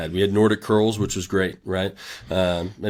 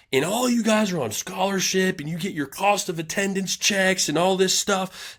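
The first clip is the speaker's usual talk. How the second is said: high, pitched-up imitation of a furious coach; angry coach rant; 10 semitones higher than usual. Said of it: "he was pissed off at the team".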